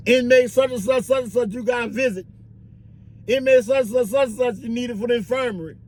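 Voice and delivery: high, sing-out summons; officer calling out. Acted. Officer summoning inmates to visit and infirmary, calling out.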